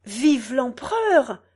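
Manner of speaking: slow, drawn-out cheer; acted imperial cheer; voice from the crowd. A narrator's reading. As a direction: cry it out from the crowd. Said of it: "dit une troisième voix".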